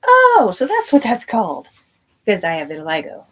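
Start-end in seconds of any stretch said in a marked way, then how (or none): 0.1-1.7 high, slow, drawn-out "oh, so that's it"; a big, dragged-out "ohh" of discovery; surprised realization. Retold or imitated. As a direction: act out a sudden dawning of understanding, up high and loud. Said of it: "I'm like".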